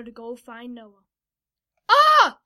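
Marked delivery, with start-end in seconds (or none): 1.9-2.4 sudden high, loud yelp; sharp startled cry, pitched way up. A narrator's reading. Noah struck by a vision, high loud yelp. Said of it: "Noah yelped".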